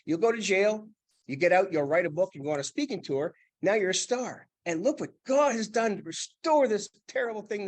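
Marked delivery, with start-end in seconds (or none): none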